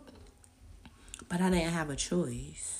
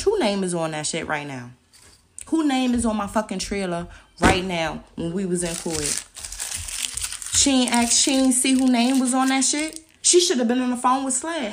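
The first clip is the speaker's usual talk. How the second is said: loud, fast angry tirade; furious rant; 5 semitones higher, 8 dB louder than usual. Real, not acted. Ranting about the lawyer and housing people, furious. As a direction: unload the anger in a shout.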